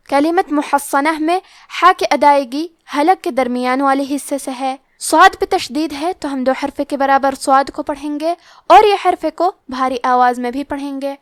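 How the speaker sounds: normal talking voice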